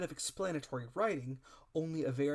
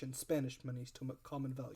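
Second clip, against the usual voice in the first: plain reading voice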